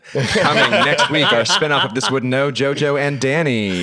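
hammed-up promo announcement for a fake spinoff; booming mock trailer read with a laugh. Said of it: "so I'm gonna ruin it".

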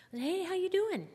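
high, loud sing-song greeting; falsely cheerful, high lilting hello; sing-song cheery. Retold or imitated. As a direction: chirp it brightly, pretending nothing's wrong. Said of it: "In the sing-song voice".

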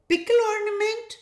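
quick, high puzzled question; baffled, fast, questioning tone; very confused. A narrator's reading. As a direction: echo it in high-pitched bewilderment. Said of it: "one very confused Ria asked".

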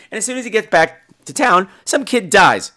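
ordinary delivery

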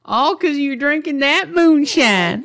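slow, very high hollering hillbilly drawl. Acted. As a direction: whoop it out like a drunk moonshiner. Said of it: "I combined the accents there".

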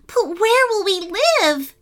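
high anxious little cry; worried child-bat plea. A narrator's reading. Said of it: "cried Bitsy".

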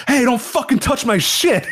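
high-pitched mock yell; retold angry shout. Commentator yelling mid-match at someone touching his stuff, mock-angry. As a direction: burst out indignantly, imitating him. Sudden outburst during broadcast, retold.

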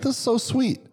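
high, gushing mock delight; delighted over a free gift. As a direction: squeal it like a thrilled customer.

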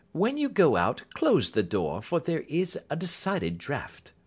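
high, light, polite dismissal; Holmes, airily amused. Acted. Holmes asks Roylott to close the door, airily unruffled.

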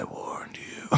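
unvoiced breathy phone whisper. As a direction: whisper a menacing warning. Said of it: "breathy voice".

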